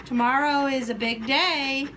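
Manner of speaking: normal talking voice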